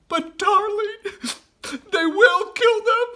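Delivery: high, loud sobbing plea; mother weeping to her husband; tearful wail. Acted. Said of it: "sobbed Missus Fox".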